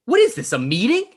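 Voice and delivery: shocked outburst. Acted. Shocked at seeing them.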